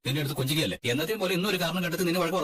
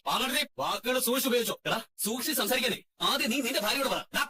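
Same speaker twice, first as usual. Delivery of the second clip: high, sharp warning; angry warning; 8 semitones higher than usual. Acted. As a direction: put him in his place. Quarrel, warning the brother. (ഉയർന്ന സ്വരത്തിൽ താക്കീത്)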